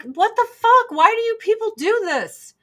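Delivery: high indignant mock rant; mock outraged passenger. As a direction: blow up at the drinkers. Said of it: "they're like".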